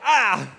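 loud short yelp of pain. Retold or imitated. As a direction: cry out as your nose hits the door. Nose hits door, cries out.

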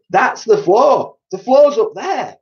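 very high, loud, panicked; panicked shrieking. A narrator's reading. Missus Twit realising they stand on the ceiling, very high panic. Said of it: "screamed Missus Twit".

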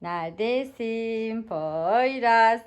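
drawn-out sing-song searching; slow sing-song calling. Pretend. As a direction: stretch the words like a game.

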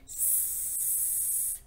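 long soft hissing s; snake hiss. Shown as a demonstration. Teacher hissing like a snake for the kids. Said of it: "pretend to be a snake with me".

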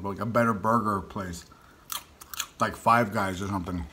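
speaking with food in mouth; snacking between words; chewing while talking. Real, not acted. Seen 0: Pops small food bits into mouth at +2.7 and +9.5 while talking.

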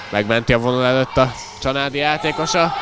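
normal talking voice

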